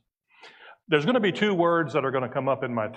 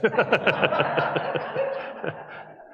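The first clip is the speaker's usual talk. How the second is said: quick burst of higher-pitched laughing; real laugh tapering off; laughter; 7 semitones higher than usual. Real, not acted. Speaker amused after wishing an absent colleague well, laughing.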